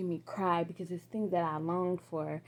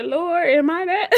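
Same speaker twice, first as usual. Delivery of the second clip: high, loud, slow disbelieving ask; shocked question to God; 10 semitones higher, 14 dB louder than usual. Retold or imitated. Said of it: "scratch my head and say".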